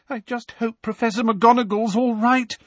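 high, plaintive worry; tearful. A narrator's reading. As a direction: fret as if close to tears. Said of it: "said Lavender tearfully".

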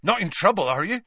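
anxious half-giant asking quickly; alarmed Hagrid. Acted. Hagrid fears Harry is in trouble, alarmed and high.

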